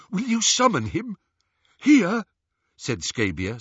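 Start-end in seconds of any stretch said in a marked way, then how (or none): 0.1-2.2 high, alarmed henchman; awed, terrified. A narrator's reading. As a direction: ask it in fearful awe. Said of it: "sounding awed, terrified".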